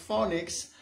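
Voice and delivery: "phonics" the common FOH-nix way; "phonics" with an O like "phone"; substandard pronunciation. Shown as a demonstration. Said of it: "that's substandard".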